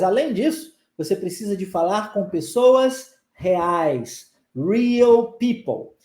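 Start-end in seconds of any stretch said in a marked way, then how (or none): 4.5-5.9 real people said slowly, people ending in an ou sound; slow model phrase. Shown as a demonstration. Teacher slowly models real people for students. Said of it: "chamar a sua atenção pra palavrinha people".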